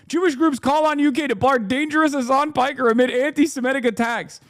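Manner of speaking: high, sing-song headline read; sarcastic falsetto-ish reading; mock headline voice. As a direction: squeak the headline in mockery. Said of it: "reading headline in mocking high".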